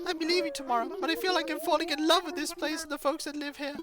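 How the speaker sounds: high, quick, gushing villager voice; chirpy high-pitched character voice; eager game villager. Acted.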